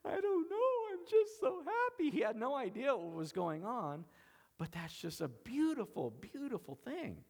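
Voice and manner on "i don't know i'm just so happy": put-on much higher, louder, crying through the words like a happy child; son crying for joy. Father recalling his son crying for joy, high tearful voice. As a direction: imitate the little boy sobbing with happiness. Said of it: "He goes".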